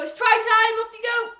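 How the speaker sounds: high, loud rallying shout; excited call. Real, not acted. Coach launching kids' drill.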